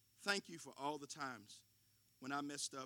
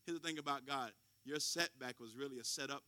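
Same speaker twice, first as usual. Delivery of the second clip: ordinary delivery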